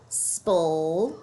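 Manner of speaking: "spol" said as the s-p-l blend sound. Shown as a demonstration. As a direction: isolate "spol" on its own. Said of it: "we have a three-letter blend".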